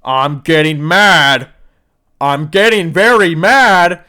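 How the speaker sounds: high, loud, slow hammed-up scream; mock scream. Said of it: "starts screaming".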